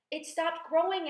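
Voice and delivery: high-pitched announcement; excited cry. A narrator's reading. Aunt announces the peach stopped, excited cry. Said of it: "Aunt Spiker cried".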